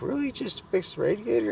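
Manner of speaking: imitated woman, surprised. Woman questions the radiator repair, imitated high and surprised. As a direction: pitch it way up for her disbelieving question. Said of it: "And she's like".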